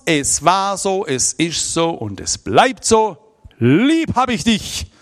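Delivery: slow, loud, every word weighed; an old father declaring his love, slow and loud; solemn declaration. Retold or imitated. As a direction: spell it out slowly like a vow.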